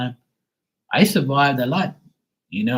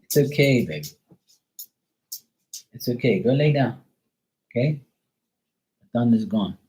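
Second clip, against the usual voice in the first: soothing a scared dog. Real, not acted. Soothing dog after thunder.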